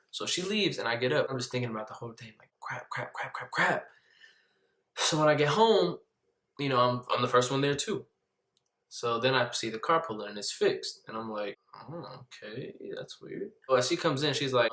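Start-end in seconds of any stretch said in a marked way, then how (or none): 2.6-3.9 hushed rapid repetition; anxious muttering. Retold or imitated. Worrying about the cracked windshield, hushed panic. 11.8-13.5 very soft, wary murmur; puzzled aside. Retold or imitated. Seeing the car fixed, hushed puzzlement. Acts out puzzled private reaction.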